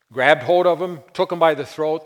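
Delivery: loud, high preaching burst; emphatic shout. A narrator's reading. Preacher shouting to congregation about choking. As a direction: drive home the violence loudly.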